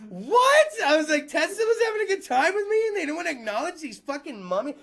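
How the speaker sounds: high, loud yelp of disbelief that runs into a rant; stunned disbelief. Retold or imitated. Comic disbelief at the Tesla band's answer. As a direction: squeak out the what, then keep ranting high.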